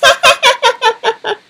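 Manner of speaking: spiteful giggle; mocking laugh. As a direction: laugh at her. Stepsister mocks Cinderella.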